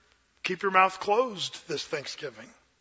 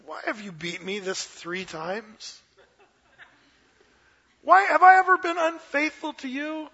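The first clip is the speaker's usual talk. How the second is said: talking donkey; 7 semitones higher than usual. Acted. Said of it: "the donkey turns around and says".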